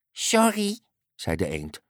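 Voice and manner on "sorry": high, meek duck voice; apologetic duck. A narrator's reading. Duck answering the annoyed unicorn, meek and high. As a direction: squeak out a small apology.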